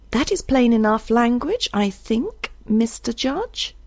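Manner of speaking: high-pitched, dryly pointed remark. A narrator's reading. Isbel remarks to Judge on the widow's threat, dryly. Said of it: "said Isbel dryly".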